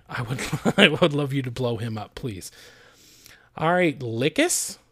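usual speaking voice

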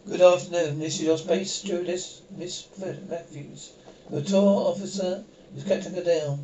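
loud cabin announcement over the speaker; stewardess announcement. Acted. Stewardess greeting passengers over the intercom, announcing loudly.

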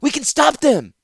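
high, loud urgent shout. Acted. Ratapi shouting to Zancha that they can act, high and loud. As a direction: yell it with hope. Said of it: "Ratapi shouted".

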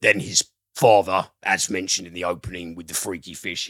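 ordinary delivery

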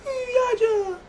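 very high whiny crying mock voice; mock Keeks whining. Teasing girlfriend, imitating her whiny teary protest. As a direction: whine like she's about to cry. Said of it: "'Cause your voice do sound like that".

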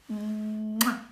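drawn-out goodnight smooch; kiss sound. A narrator's reading. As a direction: hum into a big mwah. Kiss sound after goodnight request.

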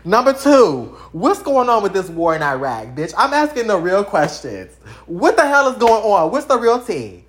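loud, cheeky demands to the President; hammed-up loud questions, all attitude; mock-outraged kid demanding answers; sassy demanding letter. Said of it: "first of all, I said".

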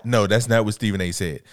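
ordinary talk, nothing marked

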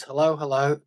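ordinary talk, nothing marked

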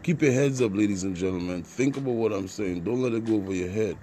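normal talking voice

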